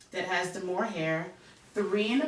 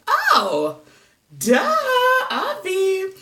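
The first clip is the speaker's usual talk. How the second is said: louder, much higher mock lightbulb moment; sudden realization; 13 semitones higher, 10 dB louder, much slower than usual. Retelling the moment the YouTube tutorial clicked, in a loud, higher mock lightbulb voice. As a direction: blurt it like the answer just clicked. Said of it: "I was like".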